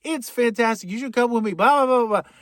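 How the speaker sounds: mock mother breezing through the door; gushing mother. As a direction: gush at her son like a pushy mom. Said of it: "and it's Alfred's mother".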